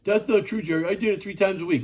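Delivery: mild mock objection from a doubter; imitating a critic. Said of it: "people out there go".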